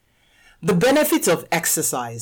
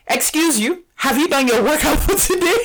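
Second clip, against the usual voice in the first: a hint of a bossy mother, pitched up; mock stern mom; 9 semitones higher than usual. A mother teasingly checking her kids' workout, mock stern. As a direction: playfully demand an answer, teasing. Mother confronts resting kids.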